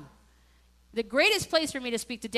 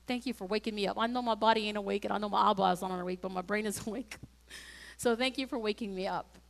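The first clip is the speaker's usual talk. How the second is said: low, soft, private murmur; sleepy prayer; 5 semitones lower, 6 dB quieter than usual. Acted. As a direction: murmur a first prayer on waking. Acts out waking-up prayer in bed.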